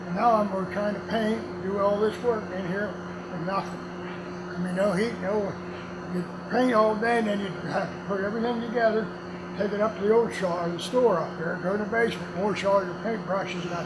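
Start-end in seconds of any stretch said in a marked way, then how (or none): none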